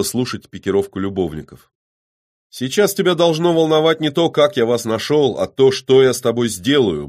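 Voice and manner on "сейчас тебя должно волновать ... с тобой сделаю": high, loud warning; gruff threat. A narrator's reading. Man warns the killer what he will do, gruffly. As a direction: threaten him in a raised voice. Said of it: "буркнул Кирилл".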